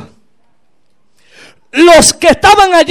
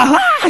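high, thin mock scream; short high-pitched "ah" of a madman; imitated scream; 12 semitones higher, 4 dB quieter than usual. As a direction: act out the possessed man's cry without full volume. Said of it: "pero él gritaba".

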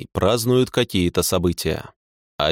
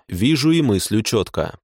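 ordinary delivery

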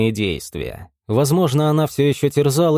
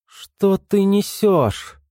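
bewildered; 8 semitones higher, much slower than usual. A narrator's reading. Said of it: "Триш искренне недоумевала".

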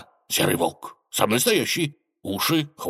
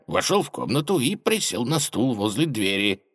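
ordinary delivery, nothing marked

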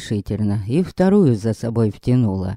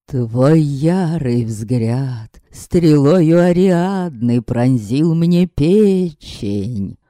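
slow, pompous verse recital; lovesick declaiming; much slower than usual. Acted. Vampire declaiming verse at Yaska, slow and pompous. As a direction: recite it slowly like a bad poet.

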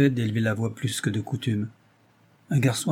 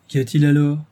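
louder, higher astonished ask; dumbfounded question; 3 semitones higher, 7 dB louder than usual. Acted. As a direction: caught completely off guard. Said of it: "demanda Sanchez ébahi".

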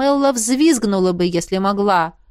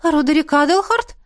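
puzzled, higher questioning repeat; bewildered; 8 semitones higher, 3 dB louder than usual. A narrator's reading. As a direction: echo the name in confusion. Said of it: "растерянно повторила".

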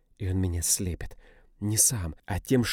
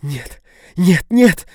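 each no higher and louder; building to a shriek; woman screaming as she resists; rising screams; 11 semitones higher, 16 dB louder, much slower than usual. A narrator's reading. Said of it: "начинает сопротивляться она".

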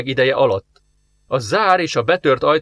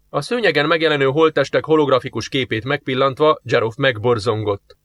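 ordinary delivery

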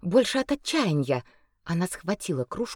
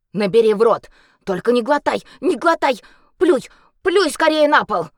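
urgent shout; 5 semitones higher, 9 dB louder than usual. Acted. Healer urgently orders spitting.

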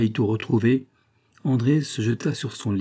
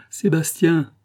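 put-on higher, louder call to a child; 7 semitones higher, 4 dB louder, slower than usual. A narrator's reading. Mother calling her missing son, higher and louder. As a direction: call out the name Sébastien into the room. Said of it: "en s'écriant".

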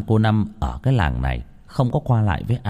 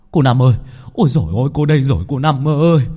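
higher, louder, rushed cry; panicked, breathless; 5 semitones higher, 4 dB louder, faster than usual. A narrator's reading. Man running up, calling out to Cô Năm in a breathless panic. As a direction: blurt it out in a flustered panic. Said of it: "giọng hớt hải".

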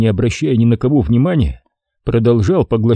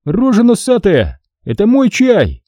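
a raised, indignant voice defending his tea; Hasan angry; 12 semitones higher than usual. A narrator's reading. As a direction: snap at him to put it back. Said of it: "раздался голос Хасана".

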